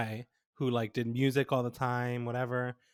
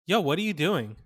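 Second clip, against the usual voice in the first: retold confrontational challenge, slightly raised; super serious; 4 semitones higher than usual. Teller imitates a dude confronting them outside class, super serious. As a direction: act out a serious, pointed challenge. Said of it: "Like, super serious".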